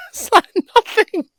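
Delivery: high, giggling through the words; high giggly answer to a gossip-hungry client; laughing reply. Retold or imitated. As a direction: laugh it off, nothing to tell. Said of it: "And I was like".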